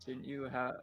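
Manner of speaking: normal talking voice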